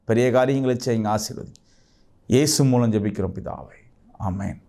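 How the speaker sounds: hushed, earnest intercession; fervent prayer. Acted. Pastor praying over his viewers, hushed and earnest.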